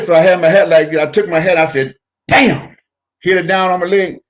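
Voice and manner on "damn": sudden damn. Retold or imitated. Retold cursing at getting caught.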